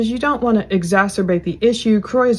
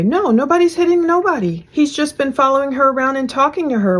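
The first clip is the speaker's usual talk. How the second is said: put-on higher-pitched quoted boy; higher kid-like denial, quoted; imitating a boy; 6 semitones higher than usual. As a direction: lightly mimic a boy's protest. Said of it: "one of the boys says".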